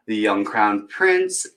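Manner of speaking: ordinary delivery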